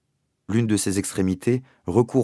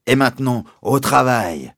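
loud, higher, harsh command; terrifying voice; 6 semitones higher, 7 dB louder than usual. A narrator's reading. Captain ordering the class back to work, loud and terrifying. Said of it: "a dit la voix terrifiante".

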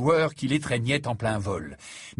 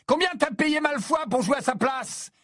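voix haute, presque chantée; le piquer pour le déconcentrer; provocation désespérée; 11 semitones higher than usual. A narrator's reading. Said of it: "dans une tentative désespérée". (Harry provoque Harper pour le déconcentrer, voix haute)

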